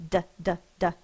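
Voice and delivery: "D, d, d" tapped loud like a drum. Shown as a demonstration. Teacher showing children how the d sound taps like a drum. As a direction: tap out the d sound repeatedly. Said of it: "tapping behind my teeth like a drum".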